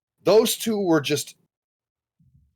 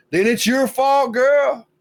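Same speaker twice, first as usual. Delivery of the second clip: higher, louder, calling her out; emphatic scolding; 7 semitones higher, 4 dB louder, slower than usual. Real, not acted. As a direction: pin the blame on her, punching it.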